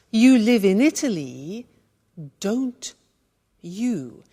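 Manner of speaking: "don't ... you" split by long pauses; step-by-step demonstration. Shown as a demonstration. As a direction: build "don't you" word by word for learners. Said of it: "So look how that works".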